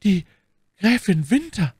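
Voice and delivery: high, halting female voice; stammering woman. A narrator's reading. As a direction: force the name out in pieces. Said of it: "stammelte Madame Bonacieux". (hoch und stockend gestammelt)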